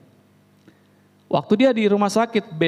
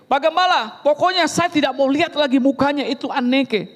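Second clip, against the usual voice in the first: higher, insistent complaint; a husband fed up and demanding; angry husband; 6 semitones higher than usual. Retold or imitated.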